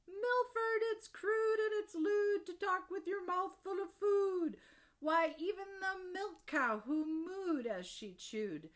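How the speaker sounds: high, loud mock-motherly scolding; hammed-up indignant mum. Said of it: "His mother said".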